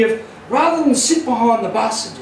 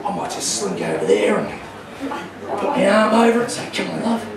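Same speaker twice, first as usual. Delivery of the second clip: smooth ferret; 4 semitones lower, 6 dB quieter than usual. Retold or imitated. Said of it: "He's thinking".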